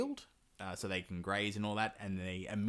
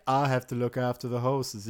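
dutiful dolt; 2 semitones higher, 5 dB louder than usual. Pretend. Dim Ivan answers dutifully.

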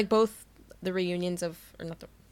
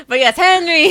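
much higher and louder; excited laughing shout; 9 semitones higher, 14 dB louder than usual. Real, not acted. Friend cheers a favourite character, excited loud shout. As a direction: burst out with it, laughing.

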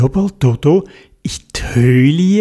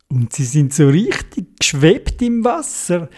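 plain reading voice

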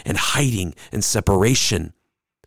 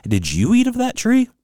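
jumps much higher on the question; high mock question; 14 semitones higher than usual. God pressing Adam, asking in a much higher voice. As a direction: ask it up high, incredulous. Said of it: "Notice the question".

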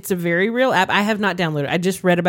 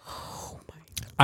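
whispering; 9 dB quieter than usual. Real, not acted. Shocked aside.

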